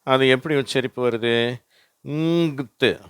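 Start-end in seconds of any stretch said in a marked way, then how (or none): 2.1-3.0 "ங்த்து", higher, humming ng into th; ng plus th. Shown as a demonstration. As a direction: merge four letters to two sounds in "ங்த்து". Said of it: "என்னும் ஜியும் சேர்ந்து இங்கா மாறிடுச்சு".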